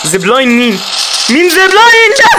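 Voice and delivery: shrill loud yelling; exasperated mom scolding. Real, not acted. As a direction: scream at your teammates. Left alone, yelling at team.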